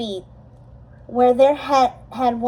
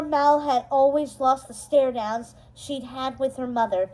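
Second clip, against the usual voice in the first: plain reading voice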